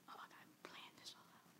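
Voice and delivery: fast, breathy secret whisper; quick conspiratorial whisper to herself; scheming whisper. Pretend. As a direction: hiss it out like a plotter. Voicing a grudge-holder's scheming thought.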